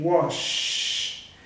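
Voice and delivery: "wash" with a long hushing SH; voiceless final SH. Shown as a demonstration. Teacher proving no vibration, "wash" with long voiceless SH. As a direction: let "wash" trail into an unvoiced SH. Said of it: "tem vibração também".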